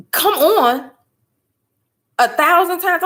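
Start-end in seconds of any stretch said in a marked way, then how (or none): 0.1-0.9 higher, louder call to hurry; impatient yelling. Retold or imitated. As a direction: hurry her out the door. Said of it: "when I start screaming".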